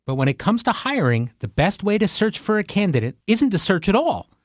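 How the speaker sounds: upbeat sponsor read. Acted. Host reading a hiring ad to listeners, upbeat ad read. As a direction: sell it like a podcast host ad.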